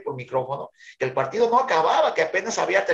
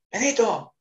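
calling out; 7 semitones higher than usual. Retold or imitated. Calling the coach's name.

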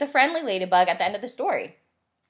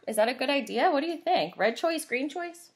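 usual speaking voice

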